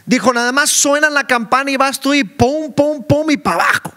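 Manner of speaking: preaching. Acted. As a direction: preach it out over the hall. Preacher addressing congregation.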